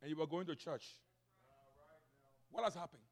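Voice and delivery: ordinary talk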